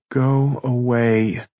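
long groaned dismissal. A narrator's reading. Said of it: "she groaned".

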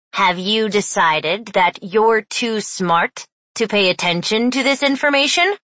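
nasal scold, rising; nasal teacher voice. Acted. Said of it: "Mister Sweeney's nasal voice".

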